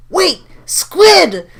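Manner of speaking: very high, loud desperate cry; panicked shout. Acted. Lear calling to Squid before it's too late, panicked shout. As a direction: yell after him in panic.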